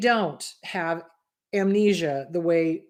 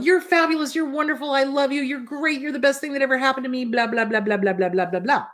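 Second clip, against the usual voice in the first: higher, gushing run of sweet talk that dissolves into blah blah; mock gushing flattery; 5 semitones higher, faster than usual. A narcissist love-bombing a new partner, mock gushing and higher. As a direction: lay the fake flattery on thick, then brush it off.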